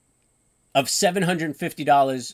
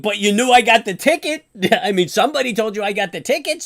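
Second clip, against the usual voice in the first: louder, higher, faster indignant outburst with a laugh; heated outrage; 7 semitones higher, 6 dB louder than usual. Real, not acted. Ranting at the New York ticket office, real heated outrage. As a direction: let the anger boil over with a bitter laugh. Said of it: "now you got my, my blood boiling".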